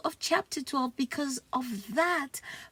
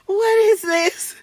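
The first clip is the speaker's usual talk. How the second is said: loud, higher, outraged "what is this"; angry demand; 8 semitones higher, 13 dB louder than usual. Real, not acted. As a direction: confront him sharply and loudly. Teacher confronts about paper.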